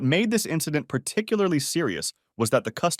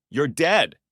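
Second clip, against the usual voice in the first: angry shout, louder and higher; 4 semitones higher, 4 dB louder than usual. A narrator's reading. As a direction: yell it as a threat. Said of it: "he shouted".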